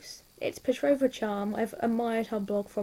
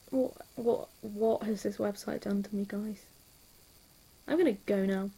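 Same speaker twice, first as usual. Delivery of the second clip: ordinary talk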